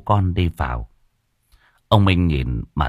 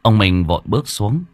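ordinary delivery

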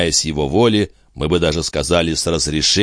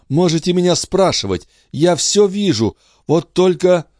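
high-pitched, excited woman's voice; eager mother; 12 semitones higher than usual. Acted. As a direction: agree eagerly, voice lifted high. Обрадованная Андре соглашается. (обрадованная мать, высоким голосом)

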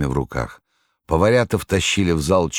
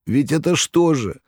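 bitter complaint; 10 semitones higher than usual. A narrator's reading. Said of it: "горько говорил Никанор Иванович".

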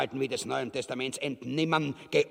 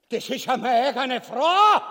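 überrascht; 8 semitones higher, 6 dB louder than usual. Acted. Ubu erkennt seine Frau.

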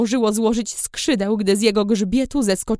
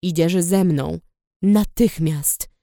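low firm command; 6 semitones lower, slower than usual. A narrator's reading. Rider grabs her hands.

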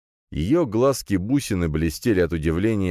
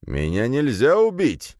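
higher, drawn-out cocky declaration; smirking boast; 7 semitones higher, slower than usual. A narrator's reading. Ghostly guardian to hero, smirking boast. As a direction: stretch it out with a smirk. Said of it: "ухмыльнулся Драгомир".

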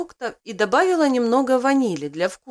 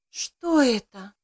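slow, drowsy murmur; sleepy voice; slower than usual. Acted. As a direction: ask it half-asleep, slowly. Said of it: "сонным голосом спросила девушка".

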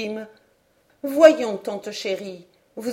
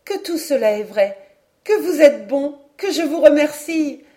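higher and louder, gushing thanks; overflowing with happy gratitude; delighted; 5 semitones higher, 6 dB louder than usual. A narrator's reading. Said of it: "s'écria Hélène ravie". (ravie, reconnaissante)

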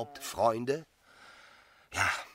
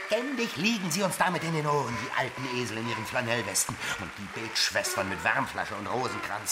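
exasperated rant; 6 semitones higher than usual. Acted. Rodolphe to Emma, mocking the pious and the speakers, scornful. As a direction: vent your contempt for the old bores. Rodolphe railing against duty talk.

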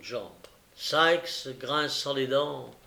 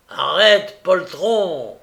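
loud, high shout after a fleeing man; 4 semitones higher, 10 dB louder, much slower than usual. A narrator's reading. Robber yells at his fleeing accomplice, loud shout. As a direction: yell to stop a coward running off. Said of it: "s'écria le brigand".

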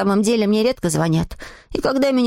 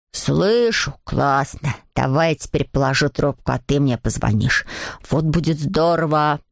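friend calling out; 8 semitones lower than usual. A narrator's reading. Alceste happily arranges a call back, low stretched-out voice. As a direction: voice a lumbering pal on the phone. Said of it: "закричал Альцест и отключился".

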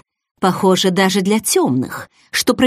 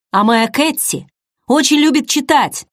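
mildly hurt, higher-pitched protest; offended grumble; 7 semitones higher than usual. A narrator's reading. Younger man defending his Kitty at dinner, offended. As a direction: stand up for her, a touch put out. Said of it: "обиженно пробубнил Шейнер".

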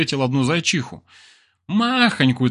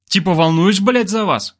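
sarcastic; 7 semitones higher, 4 dB louder than usual. A narrator's reading. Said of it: "съязвил Балалай".

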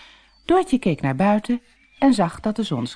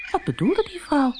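usual speaking voice

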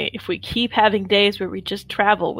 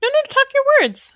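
squeaky teasing voice; 3 dB louder, faster than usual. Acted. High fast teasing voice.

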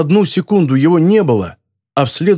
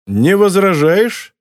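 slow, higher-pitched teasing question; mocking; 6 semitones higher, slower than usual. A narrator's reading. Moretti taunts frozen Gary before smoking, mocking. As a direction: feign politeness with a taunting lilt. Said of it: "насмешливо поинтересовался Моретти".

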